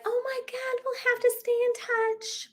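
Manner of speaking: very high, soft, slow fake sweetness; mock gushing. Event-goers promising to keep in contact, mock gushing. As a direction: coo it in a tiny insincere voice.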